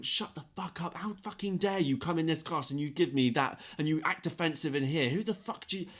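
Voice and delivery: high, held-back imitation of screaming; mock screaming rant. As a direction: rant indignantly without full volume. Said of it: "he was just screaming".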